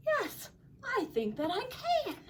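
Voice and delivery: high, light storybook reply; high-voiced, modest agreement; tentative yes. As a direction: say it pitched well up, without force. Kevin agrees, first take.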